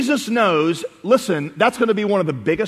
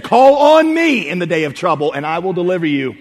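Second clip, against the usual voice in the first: emphatic divine call; 4 dB louder than usual. Retold or imitated. Preacher quotes God's promise to the congregation, loud proclamation. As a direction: proclaim it like a summons.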